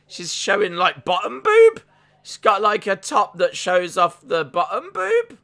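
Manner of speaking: high, loud, flustered exclamation; scandalised disbelief. Real, not acted. Host reacts to a wrestler's revealing top, scandalised. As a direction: blurt it out, voice pitched right up.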